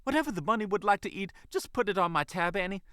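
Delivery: high, rapid, excited chatter; extremely excited. Acted. Waitress leaving instructions with her manager, extremely excited. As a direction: rush it out high and fast. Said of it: "began to sound extremely excited".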